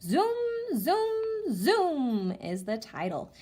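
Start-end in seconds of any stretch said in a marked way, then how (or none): 0.0-2.3 high, bright sing-song zooms; excited read-aloud. Shown as a demonstration. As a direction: sell the rocket noise to the kids. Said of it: "Here we go".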